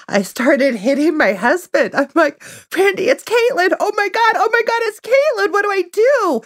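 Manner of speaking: high, breathless excitement; squealing with disbelief; excited. Retold or imitated. Excited retelling of text arrival.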